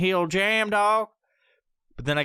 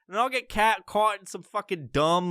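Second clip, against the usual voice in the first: normal talking voice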